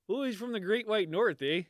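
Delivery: lightly put-on Canadian hoser voice; Canadian accent. Podcaster jokes about the Canadian guest, light Canadian accent. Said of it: "I guess I should use a different accent".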